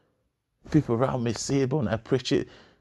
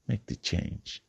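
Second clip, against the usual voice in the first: whispered urging; 5 dB quieter, slower than usual. Acted. Motivational speaker urging listeners to change, whispered. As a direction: lean in and breathe it like a secret.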